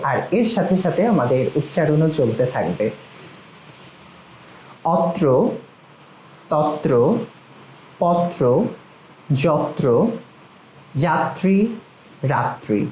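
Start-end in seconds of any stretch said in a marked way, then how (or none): 5.0-12.8 each word spoken slowly and distinctly, with long pauses between; careful drill words. Shown as a demonstration. Teacher modelling words for students to repeat, crisp and deliberate. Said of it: "আমার সাথে সাথে আপনারা বলবেন".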